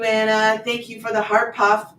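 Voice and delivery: normal talking voice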